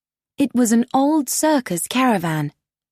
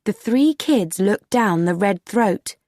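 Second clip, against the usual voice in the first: plain reading voice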